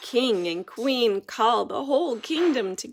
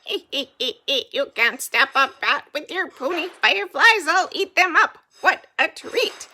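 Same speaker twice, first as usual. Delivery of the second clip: loud, higher squeaky bat voice; squealing bat; 5 semitones higher, 9 dB louder than usual. Acted. Bat gloats at the fireflies, loud squeal. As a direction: taunt like a greedy bat.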